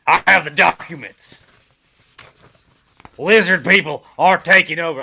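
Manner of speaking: loud hammed-up conspiracy shout; conspiracy rant. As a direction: rant like a crazed conspiracy host. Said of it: "Alex Jones. ... the crazy guy".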